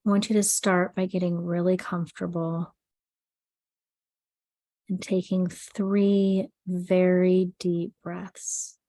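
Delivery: soothing sleep meditation read; guided meditation. Acted. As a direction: guide the listener gently into calm, unhurried. Leads an anxiety meditation.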